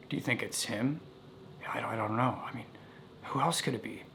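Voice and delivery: put-on hushed, breathy whisper between scared kids. Scared boys whisper to each other about the knock. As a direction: whisper it so nobody at the door hears. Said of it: "we're kinda whispering to each other".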